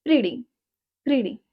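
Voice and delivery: "pretty" said as "priddy"; soft D for the T in "pretty". Shown as a demonstration. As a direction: tap the T in "pretty" American style. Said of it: "pronounce T like soft D".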